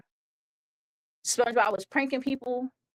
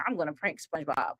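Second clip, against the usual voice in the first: lower, softer, fast plotting; scheming Squidward; 6 semitones lower, 5 dB quieter, faster than usual. Retold or imitated. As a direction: mutter the plan under your breath. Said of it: "So he's like".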